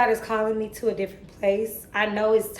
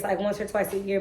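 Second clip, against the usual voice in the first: normal talking voice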